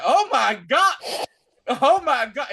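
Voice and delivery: high, loud mock horror; hammed-up, shouted disbelief; horrified exclamation. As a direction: cry out in acted-out shock. Acting out Sophia's horrified reaction.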